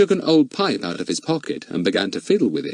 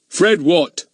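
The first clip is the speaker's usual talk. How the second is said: impatient policeman; 9 semitones higher, 5 dB louder than usual. A narrator's reading. Goon impatient.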